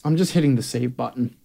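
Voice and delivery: ordinary talk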